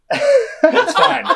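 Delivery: very high voice through laughter; high-pitched, laughing imitation; Dan Harmon impression. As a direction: squeak it out while laughing. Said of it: "He's like".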